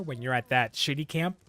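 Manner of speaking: ordinary talk, nothing marked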